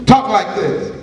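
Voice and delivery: usual speaking voice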